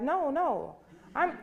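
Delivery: ordinary delivery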